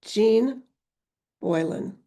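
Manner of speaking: ordinary delivery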